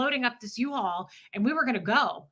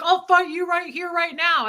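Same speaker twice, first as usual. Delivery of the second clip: higher, louder mock threat; mocking tough guy; 7 semitones higher, 3 dB louder than usual. Wife mimics the neighbor threatening her. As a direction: sneer it like a bar bully. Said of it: "He's like".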